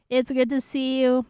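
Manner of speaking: usual speaking voice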